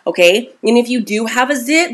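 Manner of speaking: ordinary talk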